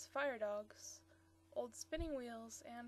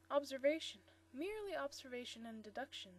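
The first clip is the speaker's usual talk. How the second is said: ordinary delivery, nothing marked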